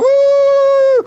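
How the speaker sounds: very high, loud whooping yell; wild whoop. Pretend. Playing the bad guy, whoops at the camera crew. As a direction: let out a crazy high whoop. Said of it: "You want me to be the bad guy?".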